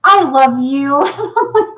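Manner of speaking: put-on slow, drawn-out I love you of a small boy; drawn-out childish declaration; little boy. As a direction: stretch it out sweetly like a little kid. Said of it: "he goes".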